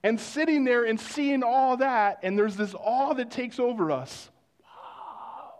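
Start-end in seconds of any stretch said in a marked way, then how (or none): 4.6-5.5 breathless whisper of awe; whispered wow. Retold or imitated. Preacher picturing the ocean, whispering in awe.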